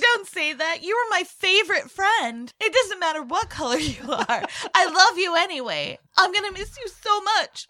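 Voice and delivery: high, loud melodramatic sobbing plea; tearful goodbye. Pretend. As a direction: wail your farewell like a soap opera. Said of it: "Dramatically say goodbye to Frosty the Snowman".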